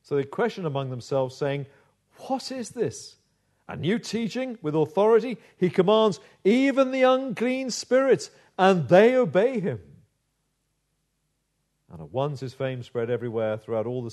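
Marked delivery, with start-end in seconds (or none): 2.3-9.8 much higher, louder astonished questions; amazed crowd. Acted. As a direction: marvel out loud at his authority. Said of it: "And they were all amazed".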